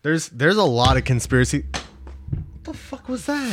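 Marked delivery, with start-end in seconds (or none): 1.7-3.5 startled, very high and slow; shocked high squeal. Real, not acted. Startled by flying prop.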